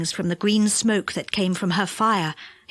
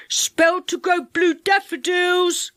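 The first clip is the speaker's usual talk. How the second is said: gnome crying wares; 8 semitones higher, slower than usual. Acted. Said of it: "cried a gnome".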